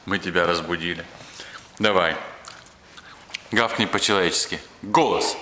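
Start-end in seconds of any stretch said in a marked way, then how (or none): none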